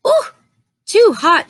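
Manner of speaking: put-on a girl yelping at hot porridge; Goldilocks, too hot.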